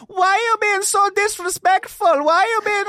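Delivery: very high, loud shrieky falsetto; mock the indignant girlfriends in squeals; exaggerated screechy female protest; imitating girls. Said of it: "he had two girls working out with him".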